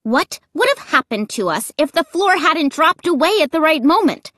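high, urgent, insistent question; agitated, pressing challenge; demanding. A narrator's reading. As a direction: demand an answer, voice rising. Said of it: "I demanded".

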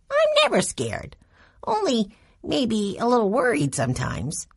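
put-on high, proud little boy; a small boy bravely denying fear. A narrator's reading. Benny insists he is brave.

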